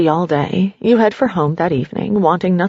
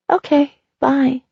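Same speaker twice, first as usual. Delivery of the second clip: little voice; 6 semitones higher than usual. A narrator's reading. Teddy's little voice.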